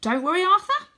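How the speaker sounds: high, loud confident call; high loud brave promise; heroic reassurance. Acted. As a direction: reassure him like a hero. Hero reassuring friend.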